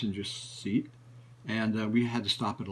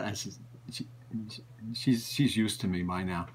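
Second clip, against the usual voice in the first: ordinary delivery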